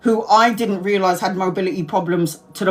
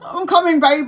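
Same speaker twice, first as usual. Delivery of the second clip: high, laughing call to the other room; mock-cheerful high laughing call; laughing reply; 9 semitones higher, 4 dB louder than usual. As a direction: sing it out nervously through a laugh. Brother answering girlfriend, laughing.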